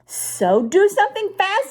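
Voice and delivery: high, loud urgent fish voice. A narrator's reading. The fish urges the children to act fast, high and loud. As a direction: hurry them along in a panic. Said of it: "said the fish".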